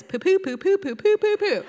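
high-pitched string of toot-like syllables; silly poo-talk. Acted. Grandma shows granddaughter her character's talk, silly poo-talk. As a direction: pop out each syllable as nonsense speech. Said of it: "You talk like this".

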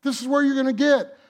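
Jesus urging Peter, loud and high. Retold or imitated. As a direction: call it out to Peter with force. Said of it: "Trying to let him know".

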